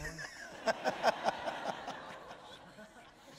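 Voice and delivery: long, high, breath-filled real laugh. Real, not acted. Dad amused by little son's question, real long laugh. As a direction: let a long laugh trail away.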